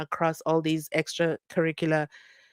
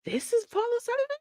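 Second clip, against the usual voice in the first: high, fast, stunned question; disbelief; 4 dB quieter, faster than usual. Real, not acted. Host doubting the footage, asked high and fast. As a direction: ask it in shock. Incredulous at the video.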